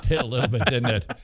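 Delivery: laughing through the words; chuckling as he answers. Real, not acted. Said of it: "laughing at the impression".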